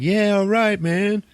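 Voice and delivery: high, loud dismissive "yeah, all right"; mock bored bros. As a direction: toss it off like an unimpressed dude. Crew's bored reply, retold.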